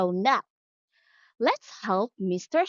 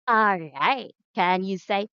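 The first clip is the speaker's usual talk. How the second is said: ordinary talk, nothing marked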